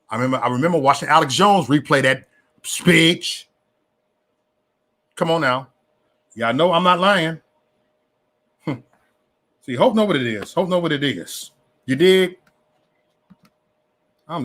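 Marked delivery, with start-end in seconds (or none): none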